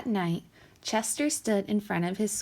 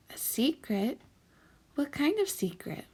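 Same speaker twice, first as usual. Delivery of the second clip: put-on curious child; 6 semitones higher than usual. A narrator's reading. Chester curious.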